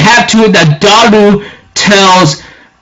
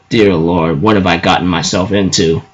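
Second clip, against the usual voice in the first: much lower, softer, dazed murmur; dazed inner thought; 12 semitones lower, 6 dB quieter than usual. Retold or imitated. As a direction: say it to yourself, overwhelmed. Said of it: "I'm thinking in my mind".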